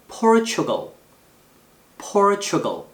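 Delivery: "Portugal" high on POR, falling after; first-syllable stress. Shown as a demonstration. Teacher models country name twice, first-syllable stress. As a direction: punch POR in "Portugal", drop the rest. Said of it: "액센트 이름절입니다".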